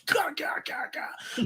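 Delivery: sharp gah gah gah bursts, the first high, the rest breathed; hammed-up noises of a flavor hitting you; mock punching grunts. Reviewing a stout, voicing its punch as gah sounds. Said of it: "a punch underneath that just goes".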